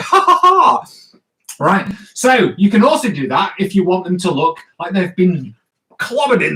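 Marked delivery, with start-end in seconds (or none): 0.0-0.8 high, loud hammed-up "ha, ha, ha"; cartoon belly laugh, much higher and louder; demo laugh. Shown as a demonstration. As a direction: act out the character laughing hard. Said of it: "they're laughing away".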